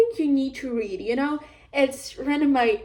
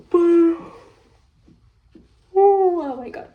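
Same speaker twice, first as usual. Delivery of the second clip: yawning; 6 semitones higher, 7 dB louder than usual. Real, not acted. Sudden yawn interrupts her review.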